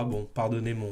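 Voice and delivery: ordinary talk, nothing marked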